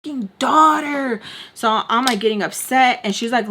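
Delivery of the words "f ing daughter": indignant retort. Retold or imitated. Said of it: "And I was like".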